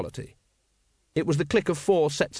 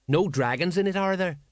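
suspicious; 5 semitones higher than usual. Acted. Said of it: "said Colon suspiciously".

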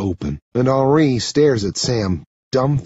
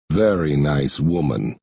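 dry, low-pitched sarcasm; understated sarcastic aside in a low voice; 8 semitones lower than usual. A narrator's reading. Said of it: "Henri says sarcastically".

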